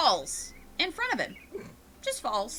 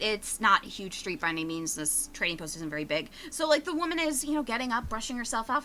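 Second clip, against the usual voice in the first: ordinary talk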